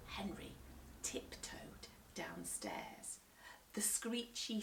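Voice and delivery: slow, soft whisper. A narrator's reading. Narrating Henry sneaking downstairs, whispered.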